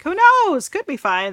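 high, loud doubtful shrug; skeptical shrug. Retold or imitated. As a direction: toss it off, unsure. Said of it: "I'm a little bit like".

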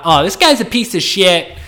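high-pitched hammed-up indignant rant; mock outraged viewer. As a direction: play an offended viewer scolding him, overdone. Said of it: "looking at me like".

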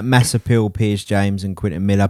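usual speaking voice

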